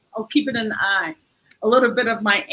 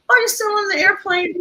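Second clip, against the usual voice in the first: put-on very high, wondering little-girl question; child's voice; 10 semitones higher, faster than usual. Grandmother repeating granddaughter's phone question, high child voice. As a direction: squeak it out as the small child.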